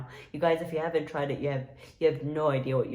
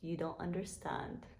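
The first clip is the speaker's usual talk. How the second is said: hushed mock-tearful; 9 dB quieter than usual. Vlogger lamenting the closed Taco Bell to viewers, hushed mock-tearful. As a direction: murmur it softly, as if about to cry. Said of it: "I'm about to cry up in here".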